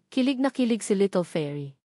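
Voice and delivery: usual speaking voice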